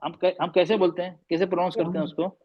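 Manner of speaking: ordinary talk, nothing marked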